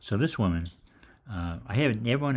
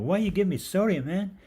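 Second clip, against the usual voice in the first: mock caller complaint; 13 semitones higher, 6 dB louder than usual. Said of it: "people saying".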